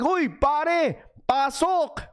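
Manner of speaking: high Tagalog call on the court; Filipino teammates calling. Retold or imitated. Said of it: "They're like".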